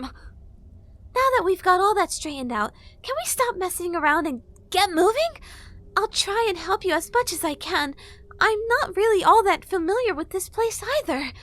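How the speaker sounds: high, loud impatient fairy; brisk, bossy fairy; fairy girl voice. A narrator's reading.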